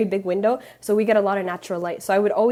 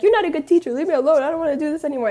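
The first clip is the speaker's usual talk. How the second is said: frustrated sister; 5 semitones higher, faster than usual. Retold or imitated. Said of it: "get frustrated and be like".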